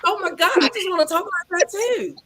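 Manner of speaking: high-pitched, bubbly admiration; bubbly gushing. Retold or imitated. Host gushes over guest's Southern accent, bubbly and high. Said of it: "'cause you're just so bubbly".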